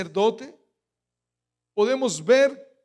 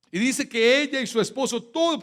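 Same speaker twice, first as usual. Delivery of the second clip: normal talking voice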